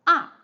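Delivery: plunge "ah" from top to bottom; falling tone. Shown as a demonstration. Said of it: "drops from the highest to the lowest".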